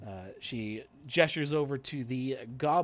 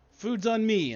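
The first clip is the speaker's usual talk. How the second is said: loud, high celebratory announcement; calling out; 11 semitones higher, 10 dB louder than usual. Acted. Game master as leader treating the party, calling out loudly. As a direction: call it out to the whole group.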